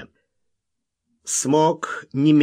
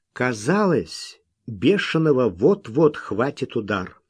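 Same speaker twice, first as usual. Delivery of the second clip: ordinary delivery, nothing marked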